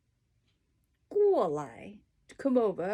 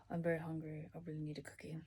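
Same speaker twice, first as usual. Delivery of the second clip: soft playful aside; 2 semitones lower, 12 dB quieter, much faster than usual. Pretend.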